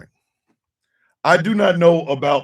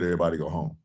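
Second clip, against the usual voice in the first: dismissive, softer and lower mock order; mocking Mike Johnson; 12 semitones lower, 12 dB quieter, much faster than usual. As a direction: brush them off in a low, bored tone. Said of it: "You heard what I said".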